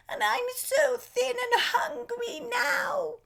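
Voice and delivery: very high, squeaky, crying plea; pleading goat. A narrator's reading. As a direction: beg for your life like a tiny goat.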